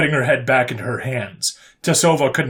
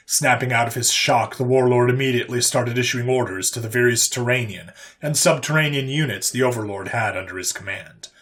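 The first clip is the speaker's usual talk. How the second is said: ordinary delivery, nothing marked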